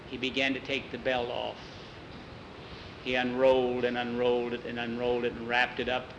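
usual speaking voice

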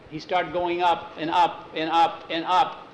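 ordinary delivery, nothing marked

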